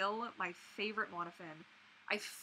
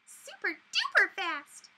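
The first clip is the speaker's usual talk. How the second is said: squeaky pony voice; 10 semitones higher, 6 dB louder, slower than usual. Pretend. Pony voice attempt continues.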